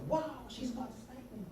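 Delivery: high-pitched mock disbelief; sarcastic kid. Boy remarking on his coming spanking, sarcastic. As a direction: marvel cheekily at your own bad luck. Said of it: "for being sarcastic".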